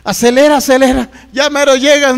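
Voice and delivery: acted roadside-emergency urgency; urgent urging. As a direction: push the driver on, acting desperate.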